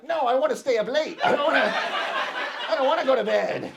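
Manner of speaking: high, loud bedtime protest; whining little boy. Acted. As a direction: throw a little kid's tantrum, dragging out the words. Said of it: "I speak like a little boy".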